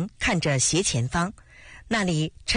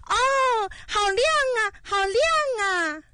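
delighted shouting. A narrator's reading. Li Na marvels at the lit bulb, delighted high cry. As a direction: cry out joyfully in a high-pitched voice. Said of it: "高兴地大叫着".

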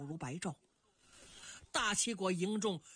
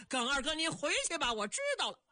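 calling back; 6 semitones higher, faster than usual. Acted. Answers the shouted farewell.

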